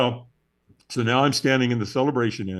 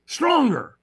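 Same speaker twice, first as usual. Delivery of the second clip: higher, pushy call for more; demanding trainer; 11 semitones higher, 3 dB louder than usual. Retold or imitated. Trainer demanding more energy from the firewalker. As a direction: egg him on, high and urgent. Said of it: "Yeah, I mean".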